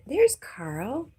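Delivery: high, loud delighted call; light up as Carl arrives; excited greeting. Real, not acted. Streamer greets viewer Carl, excited.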